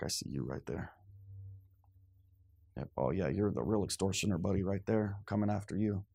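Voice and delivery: cool, unhurried, sizing them up; calm knowing Jesus. Retold or imitated. Jesus watching money changers, low and knowing. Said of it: "He's like".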